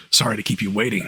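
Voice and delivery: low gravelly Snake voice; Solid Snake impression. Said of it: "Sorry, I just did a Snake impression".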